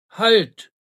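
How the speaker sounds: loud sentry's order; rough-voiced call. A narrator's reading. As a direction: call out sharply to halt someone. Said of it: "rief eine raue Stimme".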